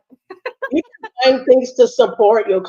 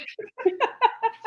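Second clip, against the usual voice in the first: high laugh; 4 dB quieter than usual. Real, not acted. Laughs at own joke.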